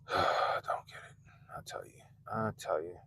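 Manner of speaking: sigh, then whispered I tell you; exhausted near-whisper; weary whisper. Real, not acted. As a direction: breathe it out, spent. Weary sigh after rant.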